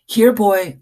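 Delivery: high, loud call to the dog. Acted. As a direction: call him over across the aisle. Girl calls the dog.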